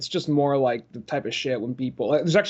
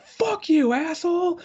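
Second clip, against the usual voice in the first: retold yell; slower than usual. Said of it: "I yelled at him".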